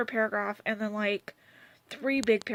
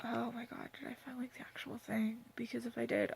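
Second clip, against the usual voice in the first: soft excited whisper; 9 dB quieter than usual. Real, not acted. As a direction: hush it, thrilled.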